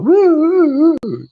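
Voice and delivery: high, loud yee-haw whoops; cowboy whoop. Retold or imitated. Preacher showing how to wave the truth around, loud cowboy whoops. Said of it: "they got their woo".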